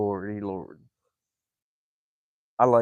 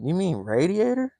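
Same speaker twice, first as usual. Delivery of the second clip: higher, rising "radiator" with a long "ay"; puzzled question; 9 semitones higher than usual. Retold or imitated. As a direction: ask back, baffled. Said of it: "I said".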